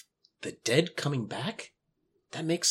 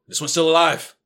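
higher, loud startled call; alarmed discovery; 3 semitones higher, 7 dB louder than usual. Acted. Plague doctor to his partner on hearing the girl cough, alarmed. Man carrying supposedly dead girl calls out.

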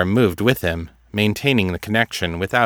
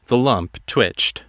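usual speaking voice

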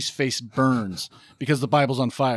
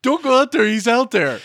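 higher, louder mock-frantic warning; 7 semitones higher, 4 dB louder than usual. Heckling the hero on screen, raised mock warning. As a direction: call out as if warning a movie character. Calling warning to film character.

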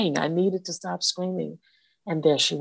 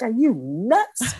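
incredulous; 7 semitones higher, 4 dB louder, much slower than usual. Retold or imitated. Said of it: "I'm like".

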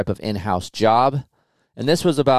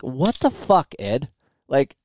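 a retold, disbelieving outburst at being fooled; stunned disbelief; 5 semitones higher than usual. Telling how he reacted after his boss Ed admitted the test, stunned disbelief. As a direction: pitch jumps much higher on what the fuck, then drops on Ed. Learns the boss was only testing.

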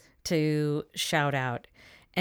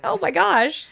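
high, loud excited squeal; excited gasp; 11 semitones higher, 7 dB louder than usual. Retold or imitated. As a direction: squeal it in delight. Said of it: "I was like".

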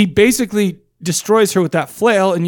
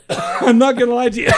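laughing through the words, higher pitch; 5 semitones higher than usual. Real, not acted. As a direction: talk while cracking up. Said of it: "I'm, like, laughing because it's like".

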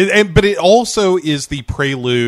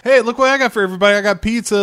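higher, quick gift-giving patter; excited dad; 8 semitones higher, much faster than usual. Retold or imitated. Dad comes home with pizza for everybody, excited. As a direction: announce presents to the whole family. Voicing dad arriving with gifts.

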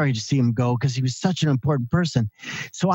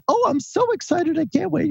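put-on much higher little-old-lady voice, delighted; grandmother on the phone. Grandson calls his hundred-year-old grandmother, much higher old-lady voice. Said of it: "She goes".

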